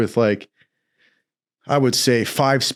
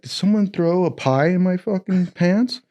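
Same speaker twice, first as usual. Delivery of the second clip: confused wake-up; 6 semitones higher than usual. Retold or imitated. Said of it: "I'm like".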